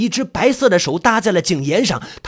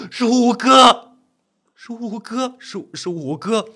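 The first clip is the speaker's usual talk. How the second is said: overcome recognition; 3 semitones higher, slower than usual. Acted. Narrator seeing fifth brother alive, overwhelmed. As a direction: cry it out, then weaken.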